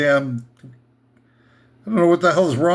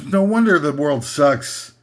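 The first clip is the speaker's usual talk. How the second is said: ordinary delivery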